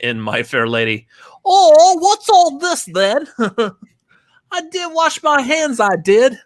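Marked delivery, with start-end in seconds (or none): 1.5-6.4 high, squeaky mock English accent, slowed and giggling; mock posh British accent. Mocking a cohost's British accent for the listeners in a high British voice. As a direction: ham up a prim British lady voice. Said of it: "His British accent is pretty close to Audrey Hepburn's accent".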